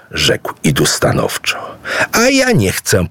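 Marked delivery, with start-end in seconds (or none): 2.1-3.1 high, firm refusal; declaring. Acted. Dimech refuses to marry Poko, high and firm. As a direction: refuse him outright, voice up high. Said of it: "oświadczyła Dimech".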